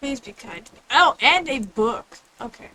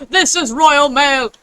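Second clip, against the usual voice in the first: announcer shout; 3 semitones higher, 9 dB louder, slower than usual. Pretend. Reading a mock announcement.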